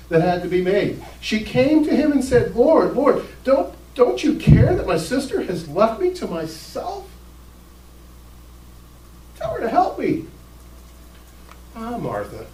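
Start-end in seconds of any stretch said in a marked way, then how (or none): 2.5-10.3 high, whiny plea; a put-upon sister pleading; complaining Martha. Retold or imitated. Martha complains to Jesus, high and whiny. 11.7-12.4 soft, low, dry sigh of a name; sarcastic. Retold or imitated. Jesus sighs at Martha, soft and sarcastic. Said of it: "that sarcastic stuff in his voice".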